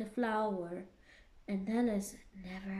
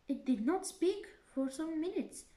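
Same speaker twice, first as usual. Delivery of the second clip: ordinary delivery, nothing marked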